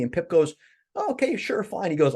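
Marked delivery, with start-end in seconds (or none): 0.9-1.9 dismissive. Retold or imitated. Pip brushing off the angel.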